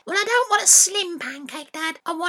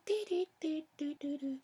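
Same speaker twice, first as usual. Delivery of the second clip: much quieter; 3 semitones lower, 13 dB quieter than usual. Acted. Boy hushing his tune because dad asks, very soft. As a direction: sing it far softer, nearly gone. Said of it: "Bit quieter".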